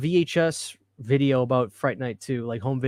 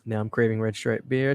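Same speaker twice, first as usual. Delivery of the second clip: ordinary talk, nothing marked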